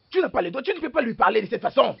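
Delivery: high, loud objection; angry protest. Acted. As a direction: push back hard at him. Friend objects during the quarrel. (protestation aiguë et forte)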